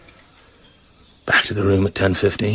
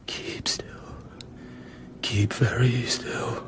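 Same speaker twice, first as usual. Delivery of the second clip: tense whisper, hardly moving the lips; tense whisper as the insect climbs his leg; 9 dB quieter than usual. Acted. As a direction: whisper as if any sound could trigger it.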